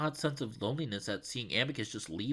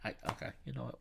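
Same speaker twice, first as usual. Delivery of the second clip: ordinary delivery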